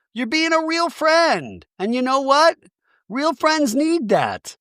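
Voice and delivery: high, slow, sermonising reassurance; preachy woman voice. Retold or imitated. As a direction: lecture her slowly like a preacher. Mary preaching comfort to Heather.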